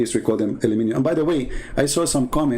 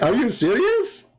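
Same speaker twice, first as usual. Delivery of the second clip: disbelief; 9 semitones higher, slower than usual. Retold or imitated. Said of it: "I'm like".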